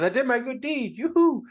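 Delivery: high, loud mock triumph; gleeful whoop. Homer reporting his good deed to Saint Peter, gleeful. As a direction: crow it, rising into a high whoop.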